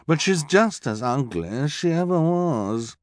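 affected glamorous sneer; snooty villainess. A narrator's reading. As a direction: look down your nose as you say it. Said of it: "Esme said".

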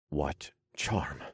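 soft, gentle question. A narrator's reading. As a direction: ask it kindly and softly. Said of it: "I asked in a gentler tone".

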